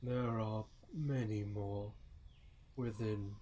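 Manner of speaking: slow, measured character voice; solemn guide figure. Acted. Guide shows Firion the temple, slow solemn voice. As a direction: announce it slowly, with long pauses between phrases. Game master's figure answers.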